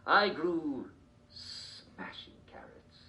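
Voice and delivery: normal talking voice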